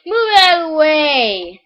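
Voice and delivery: high, loud, slow drawn-out yell; imitating impatient driver. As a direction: holler like a driver stuck behind someone. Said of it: "'Cause you know how people".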